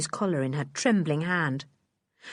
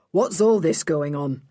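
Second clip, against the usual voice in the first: firm official question; a hint of stern inspector; 2 semitones lower than usual. The inspector questioning the scene, lightly stern. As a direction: demand an account.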